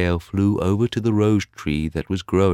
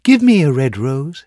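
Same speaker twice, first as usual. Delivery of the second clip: nightingale crying out; 9 semitones higher, 10 dB louder than usual. Acted. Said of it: "she cried".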